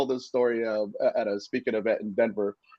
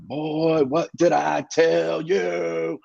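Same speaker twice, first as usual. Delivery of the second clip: slow, higher barking drill-sergeant command; army voice; 6 semitones higher, much slower than usual. Retold or imitated. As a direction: imitate a drunk father barking like a drill instructor. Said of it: "This army voice".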